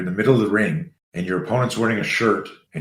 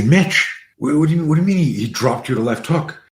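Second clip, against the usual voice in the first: raised pitch, exasperated disbelief; incredulous questioning; 5 semitones higher, 4 dB louder than usual. Retold or imitated. Coach asking why his student got hit, incredulous. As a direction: fire off retold questions, voice high and incredulous.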